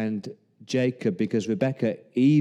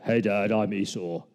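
put-on lower voice of an impostor; corrected, deeper disguise voice; posing as brother; 4 semitones lower than usual. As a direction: drop it down to pass as the brother. Corrects himself to pose as Esau.

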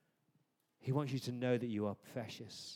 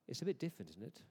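ordinary delivery

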